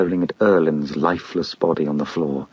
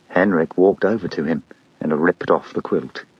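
usual speaking voice

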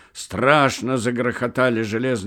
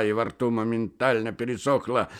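ordinary delivery, nothing marked

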